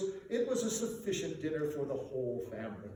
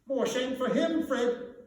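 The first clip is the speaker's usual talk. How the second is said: higher and louder, scolding; indignant; 8 semitones higher, 5 dB louder than usual. A narrator's reading. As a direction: protest with righteous heat. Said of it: "said Scrooge's niece indignantly".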